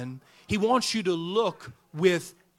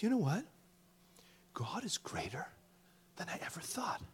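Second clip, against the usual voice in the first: whispered, like a secret revealed; hushed whisper; 4 semitones lower, 4 dB quieter than usual. Retold or imitated. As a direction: lean in and whisper the punchline. Kid tells the punchline.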